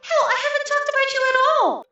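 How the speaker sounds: quarreling sister. Acted. Sushi retorts to Ao, high and loud. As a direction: hit back hard.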